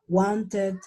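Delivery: "wanted" with a full "ted", vowel like bet; pronounced as spelt. Shown as a demonstration. Teacher demos the learner's spelling-based "wanted", ending in full "ted". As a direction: say "wanted" letter by letter, Spanish-style. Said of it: "se pronuncia tal y como se ve".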